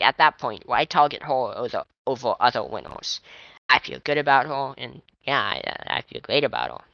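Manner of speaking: ordinary talk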